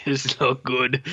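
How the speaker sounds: lightly voiced caveman; blunt mock caveman approval; caveman talk. Imagining the director judging his own film, mock caveman. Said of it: "like a caveman".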